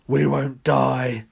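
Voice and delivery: gruff bear. Acted. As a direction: grumble out the tale like a wronged bear. The bear answers Odd.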